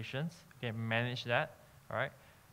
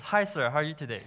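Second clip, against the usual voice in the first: higher, louder, upbeat greeting; high energy; 4 semitones higher, 5 dB louder than usual. Shown as a demonstration. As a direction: greet the customer with lifted energy. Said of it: "that's a big difference".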